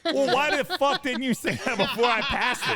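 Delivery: high-pitched, mock-angry outburst; exasperated retort. Driver retelling a spat over a missed restaurant, exasperated. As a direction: demand it with laughing indignation. Retold car quarrel with wife.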